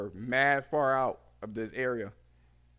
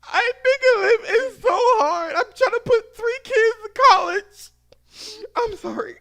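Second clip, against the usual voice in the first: mock sobbing breakdown; 14 dB louder than usual. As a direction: wail like a caller losing it. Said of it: "This bitch had a breakdown on the fucking phone".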